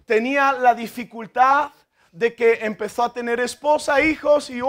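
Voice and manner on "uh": «uh» largo y agudo; exclamación de apuro. Acted. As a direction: alarga un aullido ante la carga. Exclama ante la carga creciente. (ululato exagerado de apuro)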